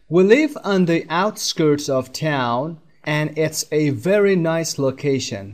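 careful dictation pace; slow textbook reading. Shown as a demonstration. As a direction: read the sentence slowly and clearly for learners. (خواندن آهسته متن درسی)

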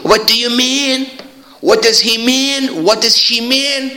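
high, sustained pitch up to "mean"; questioning tone. Shown as a demonstration. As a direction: hold the question high right through "mean". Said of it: "تون تون".

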